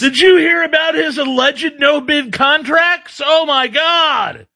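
high, drawn-out mock alarm; mock panic. Co-host joining the barbershop bit, exaggerated high panic. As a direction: play the horrified gossip, slow and high. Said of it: "you're panicking and going".

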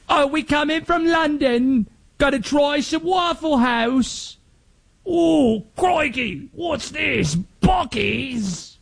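a cartoon Londoner's British accent, shouting crikey; British accent with a mock yell; bad British accent. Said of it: "My accents are awful".

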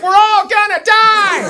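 very high, slow mock wail; panicked daughter. Daughter panicking as the canoe rocks, in a high mock wail. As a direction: shriek it like a scared little girl. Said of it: "My daughter's like".

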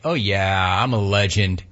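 low, self-satisfied mock swagger; cocky player impression. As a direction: drop the pitch and play the pleased-with-himself hero. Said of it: "Taylor's like".

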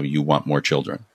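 normal talking voice

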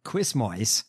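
"Quizmois" for Christmas, ending in "moys"; slow, put-upon "Quizmois"; mock affected pronunciation. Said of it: "This sounds like extra. Put upon".

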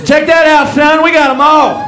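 high, loud hammed-up brag. Comic playing a fan bragging about buckets, loud mock pride. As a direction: show off the buckets like a proud fan. Voicing the proud bucket collector.

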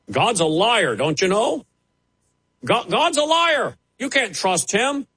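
high, sneering parody of a false pastor; mocking false pastors. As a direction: voice their lie with scorn.

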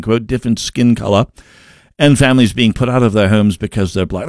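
mock BBC newsreader accent, lightly done. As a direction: read it like a posh BBC British newscaster. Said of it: "That's my B B C accent".

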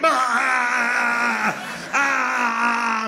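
long, high held "ah"; mock holler. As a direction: holler as if being flipped upside down. Mimes being flipped upside down.

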